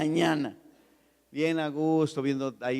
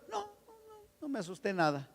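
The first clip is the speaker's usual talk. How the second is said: mock denial; 11 semitones higher, 7 dB quieter than usual. Son denying he was scared.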